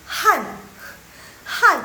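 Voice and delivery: Han said high and loud with falling tone; tone demo. Shown as a demonstration. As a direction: Han fourth tone punched loud. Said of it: "tadi Laushu bacanya Han".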